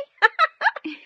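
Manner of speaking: high bright giggle; delighted laugh. Acted. Maid laughing happily over the new clothes.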